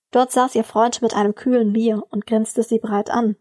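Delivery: ordinary delivery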